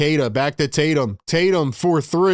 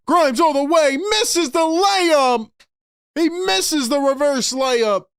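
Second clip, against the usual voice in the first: rising to a shout on the miss; excited play-by-play; 7 semitones higher than usual. Real, not acted. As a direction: call the break, build to a yell. Fast break call, missed layup.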